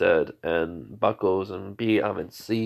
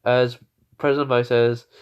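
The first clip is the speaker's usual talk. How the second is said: usual speaking voice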